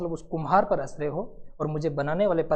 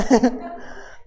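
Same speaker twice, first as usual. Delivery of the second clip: breathy laugh; 4 semitones higher than usual. Acted. Courtiers laughing.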